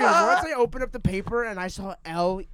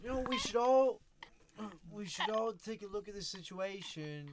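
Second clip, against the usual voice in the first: a light put-on English accent; lightly voiced British improv host; faint English accent, half-hearted; 2 semitones higher, 8 dB quieter than usual. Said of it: "I adapted an English accent".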